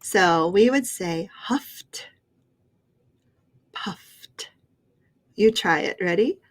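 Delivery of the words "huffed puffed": huffed and puffed, each ending in a crisp T; T sound ending. Shown as a demonstration. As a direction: end huffed and puffed on a T, with no extra syllable. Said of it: "it makes that T sound".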